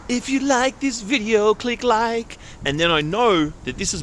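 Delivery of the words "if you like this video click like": higher, slower playful plug; sing-song nudge to hit like; hammed-up mock announcer plug, pitched higher; promo aside. Sudden like request mid story.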